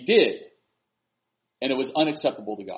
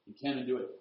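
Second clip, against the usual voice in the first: ordinary talk, nothing marked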